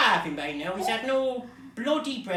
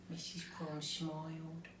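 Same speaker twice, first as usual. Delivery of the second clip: very soft storyteller's line; hushed narration; 4 semitones lower, 15 dB quieter than usual. A narrator's reading.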